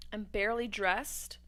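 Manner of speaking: ordinary delivery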